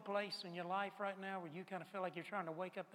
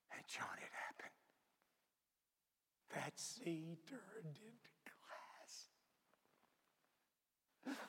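slow hushed wonder; awed whisper; 3 semitones lower, 14 dB quieter than usual. Acted. Peter telling John the sea went calm, slow awed whisper. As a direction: whisper it slowly, stunned.

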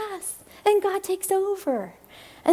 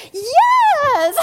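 shrieked, much higher and louder with a laugh; jump-up shout of joy; excited yes; 10 semitones higher, 7 dB louder than usual. Retold or imitated. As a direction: burst out with a delighted yes. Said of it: "I just have to show you".

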